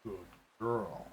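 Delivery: soft cooing to a pet. Real, not acted. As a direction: coo softly to a dog. Cooing to her dog.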